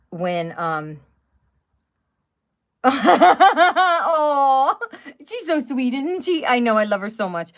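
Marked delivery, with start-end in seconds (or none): 2.8-4.8 high, loud burst of a laugh; a real delighted laugh. Real, not acted. Sudden burst at a sweet sight. 5.3-7.5 a much higher doting coo; real doting, higher voice. Real, not acted. Cooing over someone off camera.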